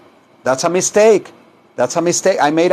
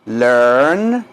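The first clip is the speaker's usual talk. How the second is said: single word drawn out for learners; repeat-after-me model; 4 semitones lower than usual. Shown as a demonstration. Teacher models one word for repetition, drawn out. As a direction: hold LEARN long with a rising end.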